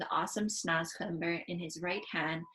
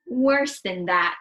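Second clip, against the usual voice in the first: slow, louder laughing groan; groaning with laughter; 10 dB louder, slower than usual. Acted. Giant agrees with Sophie, groaning with laughter. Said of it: "groaning with laughter".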